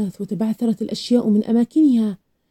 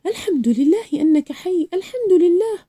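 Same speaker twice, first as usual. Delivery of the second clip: relieved cry; 7 semitones higher than usual. A narrator's reading. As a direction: exclaim in relief, pitch leaping up. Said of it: "وأصرخ".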